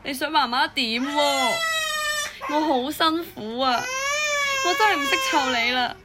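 slowed, weary plea through tears; exhausted mother at her wits' end, crying; sobbing helplessness; tearful despair. Acted. Exhausted mother crying over wailing baby.

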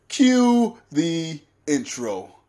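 slow, drawn-out showman call; announcer voice. Pretend. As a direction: announce it like a hype host. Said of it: "I like to say my favorite line".

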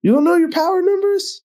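high incredulous question; can't believe he doesn't know; surprised. Real, not acted. Disbelief at friend.

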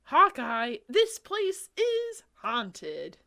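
high, slow, scared cry; frightened. Acted. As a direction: plead in fright. Frightened Amy.

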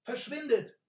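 high, loud desperate scream. Acted. Messenger screams desperately at the robbers. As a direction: scream it out in despair. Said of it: "schrie die Stimme verzweifelt".

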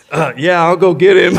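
can barely get it out for laughing; laugh-shouted lines; laughing through words. Real, not acted. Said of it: "I'm laughing and almost crying".

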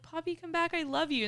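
high-pitched coaxing of a pet; pleading call. Retold or imitated. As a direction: call after the dog, re-enacted in a high voice. Calling after the dog, retold.

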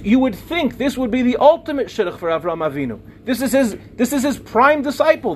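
ordinary delivery

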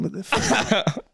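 slow, high, drawn-out what the fuck; disgusted disbelief. Real, not acted. To his mate about the disgusting sight, high slow disbelief. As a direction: stretch it out in disgusted shock.

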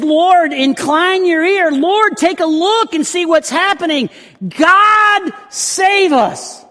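high, loud pleading cry to the Lord. Acted. Hezekiah crying out to God for help, high and loud. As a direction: call out to God for rescue. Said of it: "They cry out".

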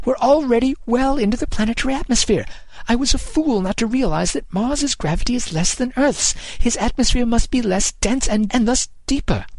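high, alarmed philosopher calling over wind; urgent calling. A narrator's reading. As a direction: shout the warning over rushing air. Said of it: "Sexton called over the rush of air".

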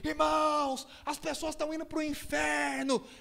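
high-pitched mock pulpit warning; imitated preacher. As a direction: imitate a preacher crying out to the congregation. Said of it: "começou a pregar e falar".

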